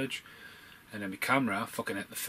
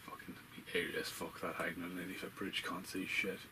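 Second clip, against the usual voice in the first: hushed whisper, much softer; 2 semitones lower, 7 dB quieter than usual. Real, not acted. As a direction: whisper as if hiding in the dark. Horror footage, hushed reaction.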